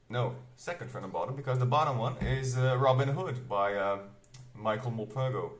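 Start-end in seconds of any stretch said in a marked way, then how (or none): none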